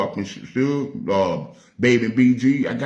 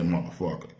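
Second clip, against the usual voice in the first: usual speaking voice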